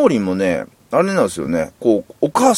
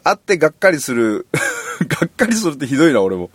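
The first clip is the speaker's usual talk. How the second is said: laughing confession; 5 semitones higher than usual. Real, not acted. Podcaster teasing his colleague and himself, laughing. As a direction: talk through real giggles, pitched up.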